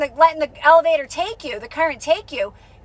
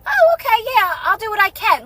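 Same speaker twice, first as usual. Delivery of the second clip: much higher, airy easygoing reply; breezy mock agreement; 6 semitones higher than usual. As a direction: toss it off lightly, letting it go. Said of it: "you just go".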